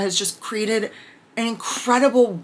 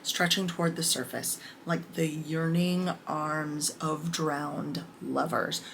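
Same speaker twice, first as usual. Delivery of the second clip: reading a quote; 3 semitones lower, 8 dB quieter than usual. Acted. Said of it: "I'm gonna say this little quote".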